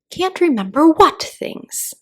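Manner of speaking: lightly voiced, probing caterpillar. A narrator's reading. Caterpillar pressing Alice, slow probing.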